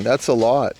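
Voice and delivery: ordinary delivery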